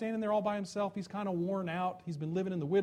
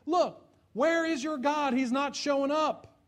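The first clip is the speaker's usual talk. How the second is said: higher, mocking taunt; 8 semitones higher, 4 dB louder, slower than usual. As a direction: needle them with scorn. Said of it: "Elijah's taunting them".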